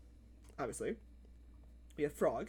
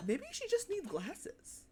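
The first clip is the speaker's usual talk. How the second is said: put-on young kid theorizing; 7 semitones higher than usual. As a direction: puzzle it out like a serious little kid. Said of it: "I distinctly remember going".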